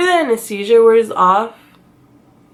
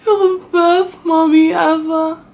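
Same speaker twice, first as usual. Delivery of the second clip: put-on higher, tearful child reassuring mom; comforting daughter; 9 semitones higher, 3 dB louder than usual. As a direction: soothe mommy like a weeping little girl. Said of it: "She's like".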